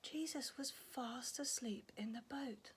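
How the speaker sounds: whispered, soft as if not to wake him; hushed storytelling. Acted. Storyteller whispering about sleeping Jesus. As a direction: whisper so he stays asleep.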